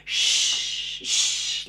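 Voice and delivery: "shh" held out as voiceless rushing air; long SH sound. Shown as a demonstration. Therapist showing a toy train sound, "shh" drawn out. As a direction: sustain "shh", then repeat it. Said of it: "one of those longer sounds".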